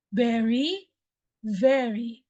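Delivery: very, first with B then V; teacher's model. Shown as a demonstration. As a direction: model very for students. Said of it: "I will say it first".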